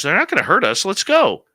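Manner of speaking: high and loud, keen to go; eager urging. Retold or imitated. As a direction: push him along, bright and high. Samantha urging Dave to come.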